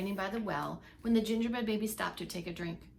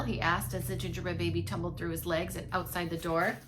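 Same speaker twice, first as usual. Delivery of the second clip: plain reading voice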